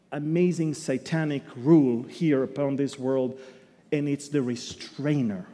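ordinary talk

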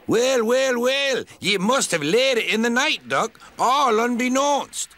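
high sly Scottish teasing; Scottish accent. A narrator's reading. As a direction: tease him, sleepy and sly. Sleepy Donald teasing in Scots.

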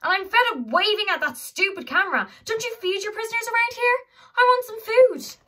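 high, loud, angry demand; shouted demand. Acted. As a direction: yell it at the jailer. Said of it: "shouted Holly".

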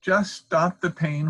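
slow, high, pained plea; whimpering. A narrator's reading. Fox in toothache begging the dentist, whimpering. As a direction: draw it out in suffering. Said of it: "whimpered the fox".

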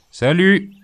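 high, loud goodbye shouted; loud farewell call. A narrator's reading. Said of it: "dit la voix". (adieu crié fort et aigu dans la nuit)

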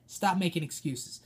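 usual speaking voice

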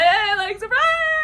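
very high, loud, giddy reveal; squealing surprise. Pretend. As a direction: squeal it out at him. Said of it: "and be like".

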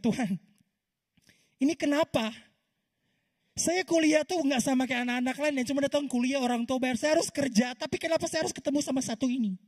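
tinggi dan keras, mengeluh kepada Tuhan; protes keras. Retold or imitated. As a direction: teriak protes yang penuh keluh. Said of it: "Saya bilang". (mahasiswa memprotes Tuhan, tinggi dan keras)

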